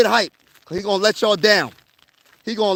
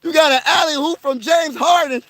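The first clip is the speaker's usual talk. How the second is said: excited shout; 8 semitones higher, slower than usual. Retold or imitated. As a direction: yell it like a fan jumping off the couch. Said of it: "I was like".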